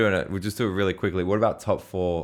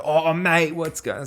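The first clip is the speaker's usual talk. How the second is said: higher, hammed-up Australian greeting; mock Aussie; 8 semitones higher, slower than usual. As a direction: overdo the Aussie mate like a tourist. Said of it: "when people put on the Aussie stuff".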